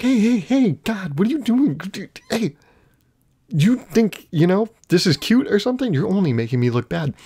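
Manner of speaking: high-pitched, flustered scolding; alarmed scolding. Retold or imitated. Man telling off a screaming dog, flustered and high. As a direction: fend off the dog in a panicky high voice.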